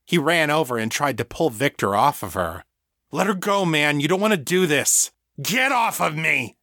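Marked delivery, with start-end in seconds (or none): 3.1-5.1 high-pitched, urgent appeal; urgent plea. A narrator's reading. Egan pulling his friend off her, urgent plea. Egan pleading during a violent struggle. 5.4-6.6 high, strained narrated roar. A narrator's reading. Victor to the friend pulling at him, roaring. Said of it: "Victor roared".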